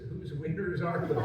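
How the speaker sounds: laughing through the punchline. Real, not acted. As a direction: crack up at the joke.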